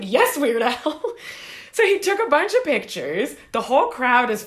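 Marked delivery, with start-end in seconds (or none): 0.0-1.0 starstruck. Retold or imitated. Starstruck fan answers her idol.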